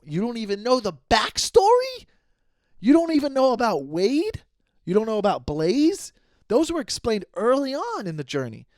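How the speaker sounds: high, loud incredulous scolding; mock outrage. Podcaster scolding a viewer who missed the backstory, mock outraged. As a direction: climb into a high, indignant yell of disbelief.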